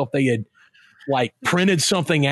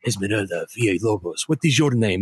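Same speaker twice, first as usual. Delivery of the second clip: low movie tough-guy voice; lightly voiced gruff burnout; Bruce Willis; 7 semitones lower than usual. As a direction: play it as a low-key growler. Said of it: "growls every time".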